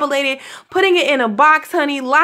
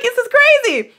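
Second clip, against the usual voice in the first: much higher, louder incredulous cry; disbelieving squeal; 12 semitones higher, 4 dB louder than usual. Retold or imitated. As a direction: squeal it in disbelief, half laughing. Retelling her shock at ringing.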